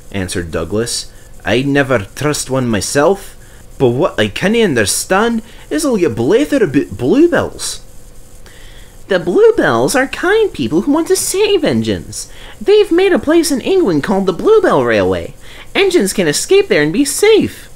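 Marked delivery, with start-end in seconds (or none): 1.4-7.8 a Scottish burr for Douglas; gruff Scottish engine talking. Acted. Douglas answering Percy in a Scottish voice. 9.1-17.7 high, eager little engine voice; Percy's voice. Acted. Percy reminding Douglas, in a high eager voice.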